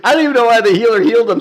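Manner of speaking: fast, high and loud through laughter; laughing at the absurd heal; laughing disbelief. Real, not acted. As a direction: blurt it out cracking up.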